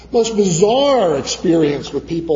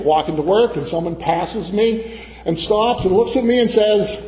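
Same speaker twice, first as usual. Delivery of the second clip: ordinary delivery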